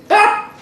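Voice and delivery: sudden high yelp; startled high cry; sharp yelp. Real, not acted. Reacts while eating.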